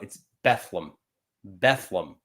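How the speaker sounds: Bethlehem squeezed to two syllables, Beth-lem; local Bethlehem. Shown as a demonstration. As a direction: drop the middle syllable like a local would. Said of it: "Around here and growing up".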